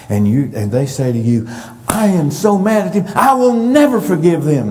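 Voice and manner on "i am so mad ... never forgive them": raised, higher-pitched hurt outburst; acted angry venting. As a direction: vow it hotly, voice jumping up. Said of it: "and they're angry".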